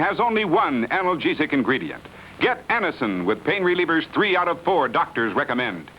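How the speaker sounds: punchy radio ad announcer; chipper 1950s ad. Acted. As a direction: sell the product with pep. Announcer closes Anacin commercial.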